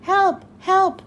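crying for help. A narrator's reading. Trapped parents calling out to Rudolph, shouting for help. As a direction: cry out for help from inside the cave. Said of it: "Rudolph heard voices crying".

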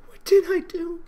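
high, tearful repeated cry, slowing at the end; Fluttershy rocking on the floor, weeping; repeated sobbing. Acted. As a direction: sob the question over and over. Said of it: "She sobbed softly".

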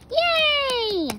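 high, loud cheer of joy; cheering yay. Acted. Friend cheering after being allowed to come, excited. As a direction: squeal it out with delight.

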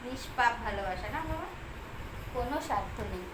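low, soft heartfelt murmur; tender. Real, not acted. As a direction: speak gently, moved by the pet. Tender reflection. (নরম আবেগে নিচু গলায়)

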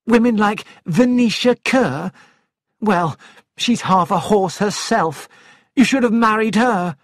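mock him and her rival; scornful wife.

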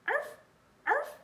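high, loud little-dog yaps; dog barking. A narrator's reading. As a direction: bark twice like a small dog. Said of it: "The barking came".

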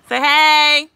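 loud, high, drawn-out parental prompt. Real, not acted. Parent prompting child on camera.

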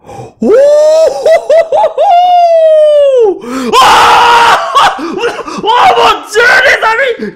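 real thrilled scream at a huge pull; ecstatic screaming. Real, not acted. Pulls a rare card. (hurlement de joie, riant et criant)

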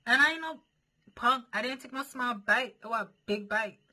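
high, loud bragging. Real, not acted. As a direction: pump yourself up loudly. Hyping self up, boasting.

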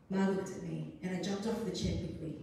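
ordinary delivery

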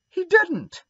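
put-on indignant boy denying Satan made him; indignant cry. A narrator's reading. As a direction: fire back in outrage. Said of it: "cried Davy indignantly".

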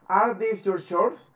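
slow, drawn-out English question; teacher's slow demonstration, rising at the end; modelled question. Shown as a demonstration. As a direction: model the question slowly for a learner. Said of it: "¿qué pregunta es esa?".

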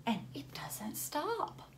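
slow whispered line; hushed whisper. A narrator's reading. Reading to kids, whispers a secret about the growing plant. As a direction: lean in and whisper it.

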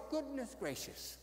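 a hushed goodness gracious, mildly amazed; taken aback, quietly marvelling; surprised. Retold or imitated. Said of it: "I guess Jesus was surprised".

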